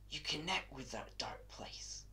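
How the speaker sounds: dark, secretive whisper; hushed confiding. Acted. Madman confiding his link with that dark place, hushed whisper. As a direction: drop suddenly to a whisper.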